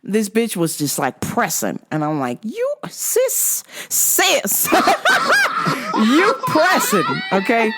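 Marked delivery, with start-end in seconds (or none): 2.4-7.7 laughing disbelief. Retold or imitated. Laughing at her pushiness.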